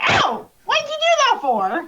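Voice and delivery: high, loud yelp easing into complaint; indignant struck pumpkin. Acted. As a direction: protest like someone just bonked. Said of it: "and he goes".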